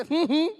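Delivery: mock artificial grin hum; forced fake smile hum. Shown as a demonstration. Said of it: "બોલે એસે". (કૃત્રિમ સ્મિતનો હમ)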